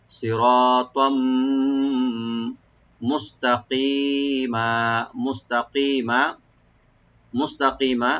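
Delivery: মুস্তাকিমা with open তা and stretched কা; tajweed demo. Shown as a demonstration. Teacher drilling students on the word. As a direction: draw out কা in মুস্তাকিমা. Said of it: "তা open রাখবেন".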